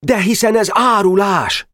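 high, loud indignant shout; shouted outrage; outraged cry. A narrator's reading. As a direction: blurt out in outrage. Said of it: "kiáltott fel Csónakos".

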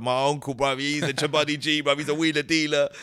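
lightly imitated Nigerian London accent. Nigerian fan boasting about his uncle, Nigerian accent. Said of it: "I got Nigerian brothers came up to me once".

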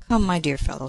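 ordinary delivery

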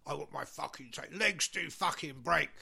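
London gangster heavy, measured menace; London accent. Acted. Manager demanding his tapes from the band, London accent threat. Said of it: "He didn't have a London accent".

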